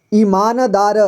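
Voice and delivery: "ईमानदार" with न fully voiced as "na"; full न. Shown as a demonstration. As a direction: say "ईमानदार" as i-maa-na-daa-ra. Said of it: "न का पूरी तरह से उच्चारण किया".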